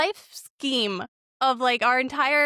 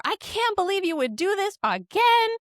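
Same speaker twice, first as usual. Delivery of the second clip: much higher, sharper accusing cry; hammed-up outburst at the husband; mock screaming match; 9 semitones higher than usual. Wife in a mock screaming match over a gift, higher accusing cry. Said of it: "a screaming match over".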